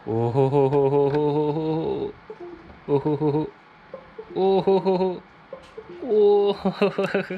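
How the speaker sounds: rising gleeful oh-ho-ho laugh; cartoon laugh. Real, not acted. Gleeful at the working drive.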